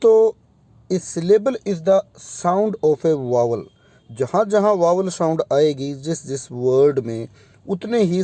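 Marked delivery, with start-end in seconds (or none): 0.9-3.6 slow, deliberate definition. Shown as a demonstration. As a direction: spell out the definition slowly. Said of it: "simplified version में मैं आपको बताऊं".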